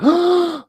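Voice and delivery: loud, high mock gasp; big scandalized intake of breath; shocked gasp. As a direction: gasp sharply in retold shock. Retold shock at the cursing.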